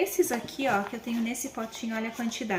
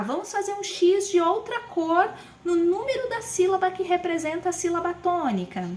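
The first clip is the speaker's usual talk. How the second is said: much higher, louder encouraging teacher; teacher voice to a child; 6 semitones higher, 3 dB louder than usual. Shown as a demonstration. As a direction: lead a child through the activity, sing-song. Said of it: "Eu tenho".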